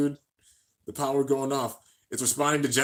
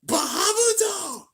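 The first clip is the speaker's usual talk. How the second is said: a long, much higher shouted launch cry; shouted call; slower than usual. Retold or imitated. Blind calling his bey, drawn-out shouted cry. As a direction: cry the bey's name slowly, full force. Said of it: "Blind using his real power".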